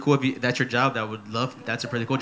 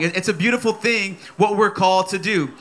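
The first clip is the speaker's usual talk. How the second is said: usual speaking voice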